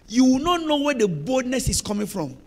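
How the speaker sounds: ordinary talk, nothing marked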